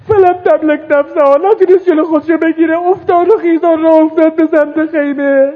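high, loud lament; rising grief. Acted. Preacher recounts Zainab rushing to the tent, rising grief. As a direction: climb into grieving wail.

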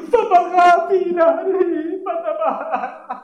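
rising lament. Acted. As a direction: let the voice break upward in grief. Mourning climax of the Karbala story.

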